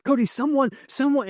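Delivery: high-pitched mimicked panic; imitated hysteria. Hysterical wife warning husband of someone, imitated in high panic. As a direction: act out a wife frantic and out of breath. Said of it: "And she's just hysterical".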